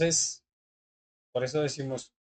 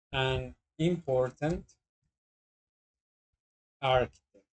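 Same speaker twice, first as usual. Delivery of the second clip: slow, deliberate reading of "an important architect"; teacher modelling a negative sentence slowly; slow example phrase; 3 semitones lower than usual. Shown as a demonstration. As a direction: spell it out slowly for the class. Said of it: "Podemos decir".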